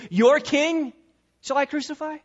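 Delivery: high, incredulous-sounding question led by 'king'; emphatic KING. Acted. Pilate rubbing it in their face, emphatic 'king'. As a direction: put 'king' up front, pitched high and punched. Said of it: "he puts king in the emphatic position".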